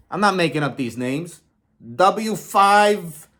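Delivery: oversell it like a carnival barker; mock excitement. Hyping a baseball card to viewers, mock excited.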